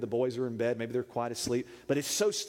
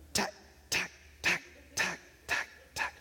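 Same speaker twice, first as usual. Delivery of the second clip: unvoiced clicking "tack, tack"; imitated nail taps; 3 semitones higher, 4 dB quieter than usual. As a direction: imitate dog nails tapping on the floor, evenly spaced. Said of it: "there will be a pitter-patter of nails".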